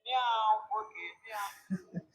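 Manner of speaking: hushed cat imitation; quiet mock meow. Shown as a demonstration. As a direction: meow softly at the mic. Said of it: "Let me record it".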